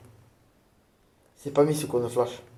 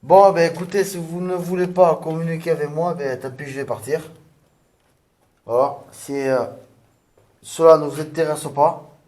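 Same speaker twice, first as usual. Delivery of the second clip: loud, challenging call into the room; 3 semitones higher, 7 dB louder than usual. Real, not acted. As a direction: call out to provoke them. Provoking spirits, calling out.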